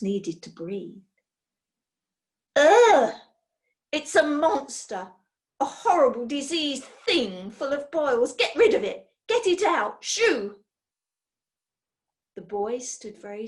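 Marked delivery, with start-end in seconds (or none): 2.6-10.6 loud, high cry of disgust at something horrible; disgusted shriek. Acted. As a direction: recoil in loud, high horror. Character recoils from a sick creature.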